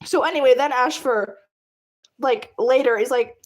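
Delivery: ordinary talk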